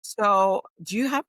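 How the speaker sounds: ordinary delivery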